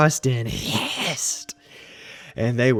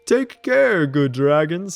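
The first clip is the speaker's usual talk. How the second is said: higher, louder wizard's farewell; old wizard; 3 semitones higher, 6 dB louder than usual. A narrator's reading. Wizard bids the dragons farewell, louder. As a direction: call out a fond goodbye as the wizard. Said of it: "he said".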